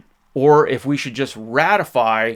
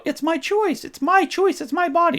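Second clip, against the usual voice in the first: much higher mock voice of the young woman, repeating insistently; mocking the young lady. Mocking the young lady he argued with, much higher mock voice. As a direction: parody her in a high sing-song. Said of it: "was all about".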